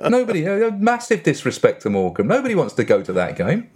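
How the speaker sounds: laughing through mock scorn. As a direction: giggle while dissing them.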